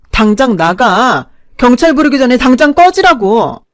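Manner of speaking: loud, high furious yell; screaming at them. Acted. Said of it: "소리를 고래고래 지르며 엉엉 울자".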